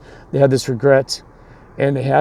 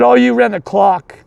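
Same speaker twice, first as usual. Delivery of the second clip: much higher, mock-yelled accusation; imitated yelling coach; 9 semitones higher, 4 dB louder than usual. Rival coach accusing the winner of cheating, mock yell. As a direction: throw it out like a riled-up coach. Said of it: "The coach starts yelling at me".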